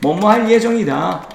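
ordinary talk

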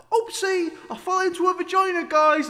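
high mocking whiny voice. Mock quote of YouTubers.

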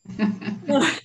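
loud burst of laughter; real amused laugh. Real, not acted. Author asked if she felt like killing, real laugh.

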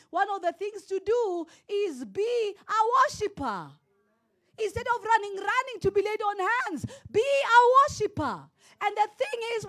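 high, loud, emphatic sermon voice; fervent preacher, key words stretched out; full-force preaching. Real, not acted. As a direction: drive each phrase home to the congregation.

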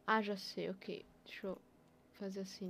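usual speaking voice

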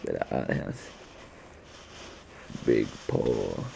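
soft whispered cooing; whispering. Real, not acted. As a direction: whisper so the dog stays calm. Whispering while dog cuddles.

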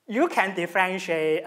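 usual speaking voice

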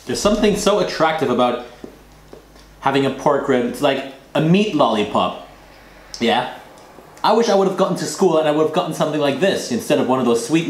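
talking between bites; eating a rib while talking. Real, not acted. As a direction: mouth near food. Seen 0: Holds rib throughout, lifts it to lips near +3.6 and +5.3.

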